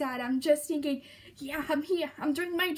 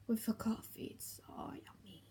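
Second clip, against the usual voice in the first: lower, soft whispered delight; 4 semitones lower, 10 dB quieter than usual. Real, not acted. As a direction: whisper it like a treat. Savouring madeleines.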